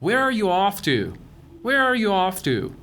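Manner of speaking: "Where are you off to" coming down at the end; slow information question, falling on "off to"; falling intonation. Shown as a demonstration. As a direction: let "off to" drop in pitch. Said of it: "رو به پایین میاد اینتونیشنش".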